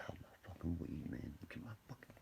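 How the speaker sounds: hushed whisper; panicked whisper. Retold or imitated. Panicking over weed and safe.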